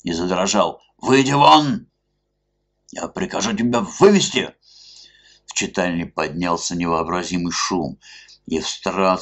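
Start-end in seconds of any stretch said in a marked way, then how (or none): 1.0-4.5 loud high furious command; angry order. A narrator's reading. Police chief ordering the masked man out, furious. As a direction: throw him out with a roar.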